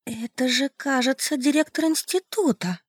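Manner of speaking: high, soft trembling voice; trembling hushed pleading. A narrator's reading. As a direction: voice shaking with shock. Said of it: "дрожащим голосом сказала она". (дрожащим голосом)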